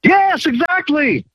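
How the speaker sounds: high, drawn-out delighted yes; excited agreement. Real, not acted. Caller thrilled about free silver repricing.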